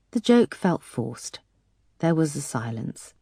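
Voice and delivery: ordinary delivery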